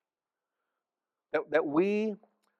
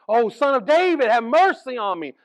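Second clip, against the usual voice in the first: loud, high plea for mercy; a blind beggar shouting to Jesus for mercy; desperate cry; 9 semitones higher, 9 dB louder than usual. Retold or imitated. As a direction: cry out to be heard over the crowd. Blind man crying out to Jesus.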